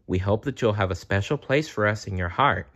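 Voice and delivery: ordinary delivery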